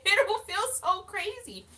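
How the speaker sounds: high, loud and laughing. Real, not acted. To her audience after the meow joke, high laughing.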